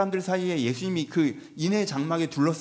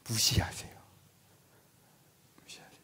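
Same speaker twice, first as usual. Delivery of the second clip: whisper; 6 semitones lower, 8 dB quieter than usual. Acted. Preacher whispers Jesus ignores him.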